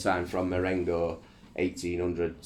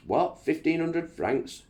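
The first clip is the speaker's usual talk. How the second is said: surprised; 11 semitones higher, 3 dB louder, slower than usual. Acted. Napoleon startled at price.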